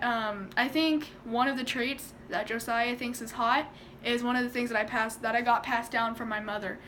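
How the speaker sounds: usual speaking voice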